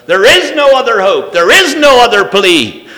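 loud, high declaration, each line hammered on is; preaching at full force. Acted. Preacher proclaims the one way to the congregation, loud and high. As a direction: thunder out that there is no other way.

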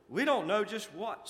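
high, nervous excuse; tremulous. A narrator's reading. Imp to the screaming prince, tremulous. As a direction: answer the ruler nervously. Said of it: "with a tremulous grin".

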